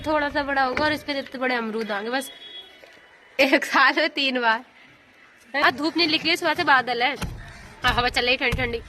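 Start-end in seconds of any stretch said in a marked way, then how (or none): none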